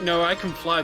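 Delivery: usual speaking voice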